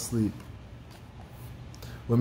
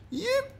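tiny high yip; short, squeaky yelp; 6 dB quieter than usual. A narrator's reading. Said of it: "he yelped".

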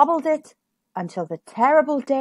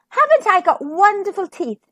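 higher, boastful little princess; proud princess; 8 semitones higher than usual. A narrator's reading. Little princess showing off her wonderful teeth, proud and high.

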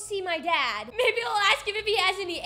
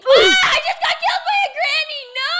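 much higher and louder, a laughing scream; laughing shriek; 8 semitones higher, 9 dB louder than usual. Real, not acted. Gamer caught by a granny in the game, laughing scream. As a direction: yelp it out laughing at the jump scare. Killed suddenly in horror game.

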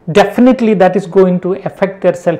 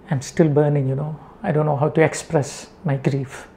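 low, quiet, grieving voice; hushed grief; 4 semitones lower, 9 dB quieter than usual. Real, not acted. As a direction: let the sorrow sink the voice low and soft. Preacher grieving over child killings.

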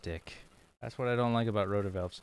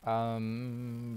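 normal talking voice

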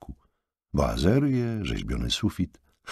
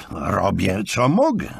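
higher, louder retort; shouting back; 5 semitones higher, 4 dB louder than usual. A narrator's reading. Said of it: "odkrzyknął Markus". (odkrzyknięcie)

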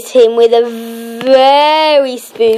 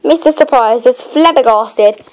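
ordinary delivery

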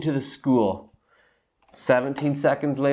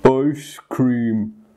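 sleepy; 3 dB louder than usual. A narrator's reading. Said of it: "responded the sleepy Mister Goose".

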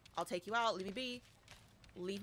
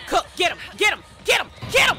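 building from loud to a high scream; urging the cook on, climbing to a scream; rising shout; 5 semitones higher, 10 dB louder, faster than usual. Real, not acted. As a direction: yell it louder and louder. Cheering teammate to attack.

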